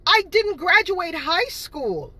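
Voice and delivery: slow, mocking echo of the lie; mocking repeat. Repeating the haters' claim, slow and mocking. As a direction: drag the accusation out scornfully. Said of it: "the other atrociousness of it was".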